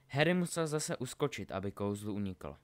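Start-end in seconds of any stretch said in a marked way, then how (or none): none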